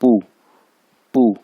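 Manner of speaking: "पू" falling sharply from high; "पू" said in the Mandarin fourth tone, clipped and falling. Shown as a demonstration. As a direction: drop "पू" fast, with a firm jolt. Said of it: "four tone इसके ऊपर".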